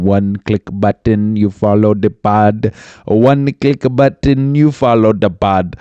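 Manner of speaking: Indian Jamaican mix. Pretend. Host urging listeners to follow, in a mixed Indian-Jamaican accent. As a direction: push the plug in a vague Indian-Jamaican accent. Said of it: "It was kind of Indian, kind of Jamaican".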